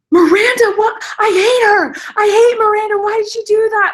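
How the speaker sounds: high, loud outraged wail; mad, near tears. Retold or imitated. Sister watching the show hates Miranda, furious high wail. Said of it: "Like, she was so mad".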